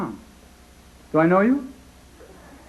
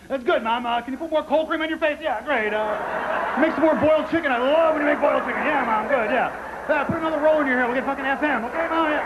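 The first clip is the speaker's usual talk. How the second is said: high mocking sarcasm to his mother; high, sing-song taunting of his mom; sarcastic kid; 9 semitones higher than usual. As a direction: needle mom while she hits you. Mocking mother while being hit.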